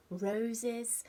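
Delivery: usual speaking voice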